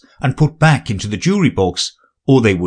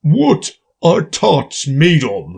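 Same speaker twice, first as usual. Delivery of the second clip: deep voice; 6 semitones higher, much slower than usual. A narrator's reading. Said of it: "he said in a deep voice".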